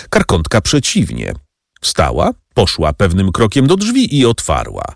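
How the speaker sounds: ordinary delivery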